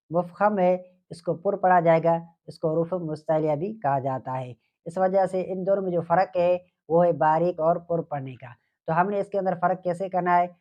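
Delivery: ordinary delivery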